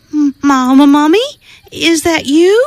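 high, hesitant little voice; nervous call. Acted. Baby hears noise in woods.

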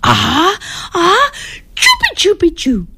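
rising sneeze with words tucked inside; half sneeze. Acted. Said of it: "half sneezed, half spoke".

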